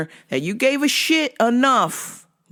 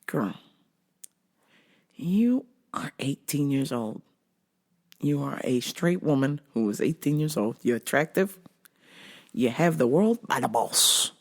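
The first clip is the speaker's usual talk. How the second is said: serious advice; 4 semitones lower, 6 dB quieter than usual. Retold or imitated. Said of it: "I was like".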